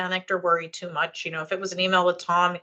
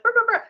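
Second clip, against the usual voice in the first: high, loud brr brr noise; silly brr noise; 5 dB louder, faster than usual. Retold or imitated. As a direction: trill it like a silly joke. Imitating a colleague's silly reply.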